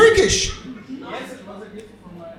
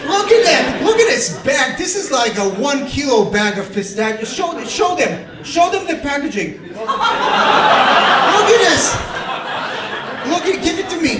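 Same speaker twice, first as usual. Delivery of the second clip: gleeful disbelief; 8 semitones higher than usual. Real, not acted. Comedian marvels at the audience member's snack bag, high and laughing.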